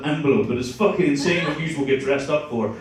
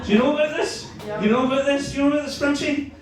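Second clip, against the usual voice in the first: high, excited repeated question; incredulous; 9 semitones higher, faster than usual. Real, not acted. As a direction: fire it at the crowd, higher each time.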